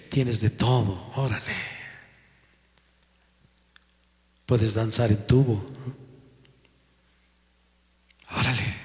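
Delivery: voice of the flesh. Retold or imitated. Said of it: "La carne dice".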